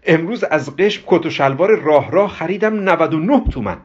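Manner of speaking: high, gleeful boasting; childlike excitement. Acted. Ghasem tells Parvin about his new suit, childishly excited. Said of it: "با اشتیاقی بچگانه گفت".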